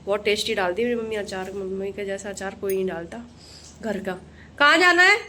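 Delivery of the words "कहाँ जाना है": sharp call across the room. Real, not acted. Mother calling after her wandering toddler, loud high call.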